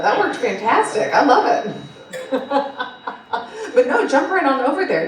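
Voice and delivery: amused, breaking into laughter mid-sentence; laughing. Real, not acted. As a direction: giggle while teasing a colleague. Panel banter, joking segue.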